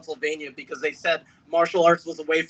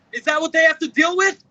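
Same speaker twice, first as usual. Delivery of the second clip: much higher, louder demanding cry; outraged shout; 10 semitones higher, 4 dB louder than usual. Real, not acted. Speaker confronts crowd about his parents' harassment, outraged shout. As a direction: confront the audience with it.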